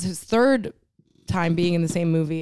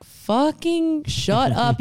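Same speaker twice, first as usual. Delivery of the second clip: very slow, higher, dragged-out "shut up"; mock angry retort; 6 semitones higher, much slower than usual. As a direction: drag out the insult in disgust. Retorting to podcast hosts.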